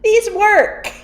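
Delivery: high-pitched comic call-out, laughter in it; mock protest. Painter calling to ladies discussing her art, high mock protest. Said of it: "I was just like".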